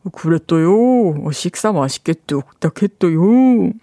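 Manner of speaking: put-on slow, lisped cutesy baby voice; lisping baby talk. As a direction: imitate a boss lisping sweetly, slowly. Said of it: "혀 짧은 목소리로".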